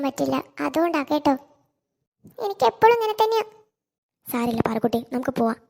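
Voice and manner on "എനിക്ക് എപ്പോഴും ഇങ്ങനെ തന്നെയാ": high, upset complaint; acted tearful complaint. Child losing the dress she wished for, tearful high complaint. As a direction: complain as if near tears, pitched high. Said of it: "കരയണ്ട".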